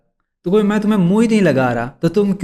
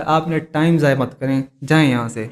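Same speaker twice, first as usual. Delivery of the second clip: ordinary talk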